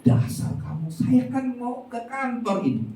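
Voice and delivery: high, heated scolding of his wife; husband scolds wife about coffee on his shirt, heated and high. Acted. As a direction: play the stressed husband snapping over a ruined shirt. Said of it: "Suaminya marahin istri lagi".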